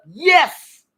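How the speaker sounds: high, loud exclamation of yes; emphatic yes. Retold or imitated. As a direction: shout the answer out as an emphatic retort. Emphatic answer to retold question.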